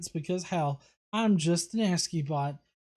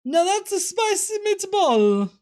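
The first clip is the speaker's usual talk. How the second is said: much higher, louder and slow hammed-up Italian accent; worst Italian accent; 12 semitones higher, 8 dB louder than usual. Host reads a viewer's joke line in a bad Italian accent. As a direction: ham up a cartoon Italian accent. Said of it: "Worst Italian accent".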